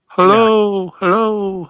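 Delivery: high put-on little voice calling hello; mock tiny person calling. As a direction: squeak it out like a tiny person down there. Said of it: "go down there and go".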